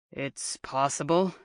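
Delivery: low, grim hedging; somber, guarded reply. A narrator's reading. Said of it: "a tone much grimmer".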